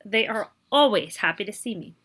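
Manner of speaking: normal talking voice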